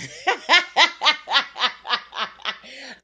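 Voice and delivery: loud, high burst of laughter; long rolling cackle of amusement; big laugh. Real, not acted. Vlogger laughing at her own bitter remark, big laugh.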